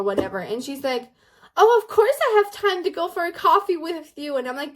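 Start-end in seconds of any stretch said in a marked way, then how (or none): 1.6-4.3 higher, louder, quick and carefree; breezy mock acceptance. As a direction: imitate a girl gushing yes to coffee. Acting carefree girl accepting coffee.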